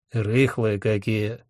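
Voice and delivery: slow, softly disapproving character voice; lordly, unhurried disapproval. A narrator's reading. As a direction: draw the words out with distaste. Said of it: "неодобрительно сказал Один".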